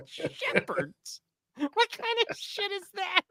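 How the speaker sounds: high, breathless incredulous whisper; whispered disbelief. Retold or imitated. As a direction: hiss it in scandalized disbelief, slowly. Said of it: "It's like".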